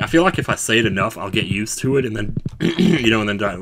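normal talking voice

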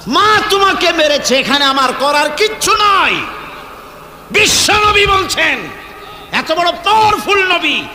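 high, ringing, emphatic sermon shout; full-force preaching. Real, not acted. Preacher exalting the Prophet as lawgiver, full-force preaching. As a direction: drive each phrase out high and forceful.

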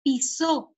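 "pisó" with the last syllable stressed; slow teacher's demo of "pisó", accent on the final O. Shown as a demonstration. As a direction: say "pisó" as pi-SÓ, leaning on the O. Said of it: "colocamos el acento en la O".

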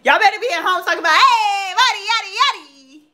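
loud, fast, high cheering with laughs; mock hype cheer. Urging friends to hype her dance, loud high cheering. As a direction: whoop it up like fans at home. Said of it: "Hype me up".

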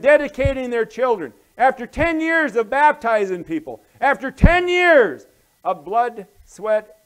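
loud, high, mounting tirade; aggrieved outburst. Acted. Slighted pastor venting to his friend, aggrieved and loud. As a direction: hammer each "after ten years" at full voice.

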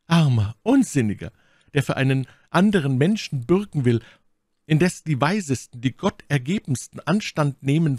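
emphatic pitying reproach from the lady; My Lady, vorwurfsvoll beschwörend. A narrator's reading. (beschwörend anklagende Lady)

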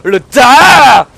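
a playful shouted Skyrim Shout; mock dragon shout. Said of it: "J'ai que le Fusro".

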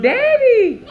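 high, loud laughing yell of Daddy; imitating her screaming for Daddy; mock scream of Daddy. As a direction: squeal Daddy like a little girl calling. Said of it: "That's what she's screaming".

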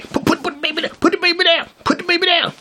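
very high squeaky mock nagging; nag. Mocking the woman nagging in his ear, squeaky nag. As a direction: repeat it in a piercingly high mimicking voice. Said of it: "She was like a nag".

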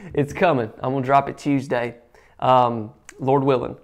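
ordinary talk, nothing marked

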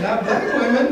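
loud, high, laughing comeback; laughing retort. Real, not acted. Laughing as she answers the surgeon. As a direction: fire it back through laughter.

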